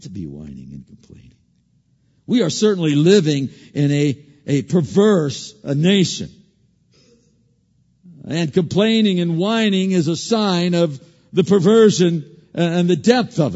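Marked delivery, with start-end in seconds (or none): 2.3-13.5 preaching. Acted. Preacher crying out scripture to the congregation, loud. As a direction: drive the scripture home.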